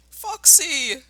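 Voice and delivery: high, loud happy call; glad cry. Acted. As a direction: shout back with relief at finding a friend. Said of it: "cried Badger".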